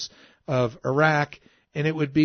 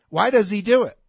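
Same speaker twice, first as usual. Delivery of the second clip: ordinary talk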